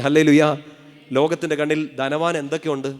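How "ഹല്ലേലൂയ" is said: loud call for a response; full-voiced preacher's prompt; rallying hallelujah. Real, not acted. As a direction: belt out the praise word. Preacher calls congregation to respond.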